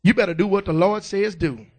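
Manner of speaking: usual speaking voice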